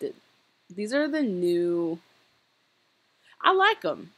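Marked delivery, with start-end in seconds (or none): 3.4-4.1 delighted squeal. Real, not acted. Delighted at new outfits.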